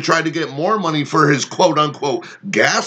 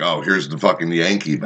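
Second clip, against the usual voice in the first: much lower sneering voice for the clerk; mocking the clerk; 10 semitones lower than usual. Clerk sizing up a Yankee customer, lightly voiced sneer. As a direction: sneer it as the hostile cashier would. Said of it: "She's like".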